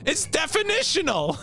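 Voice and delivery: high, incredulous insistence; laughing indignant protest. Real, not acted. Streamer insisting marketing can't spoil, exasperated high protest. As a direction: argue it high-pitched, half amused. Arguing back at spoiler accusation.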